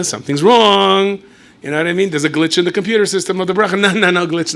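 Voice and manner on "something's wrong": mock alarm. Urging Berish back to Rebbe.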